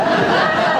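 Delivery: high unvoiced scoffing laugh; scornful laugh. Shopkeeper laughs off his treasure dream, high breathy chuckle. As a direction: laugh the notion away.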